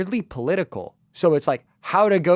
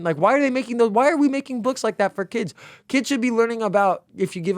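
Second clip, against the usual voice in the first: exasperated; 9 semitones higher than usual. Real, not acted. Ranting about political books.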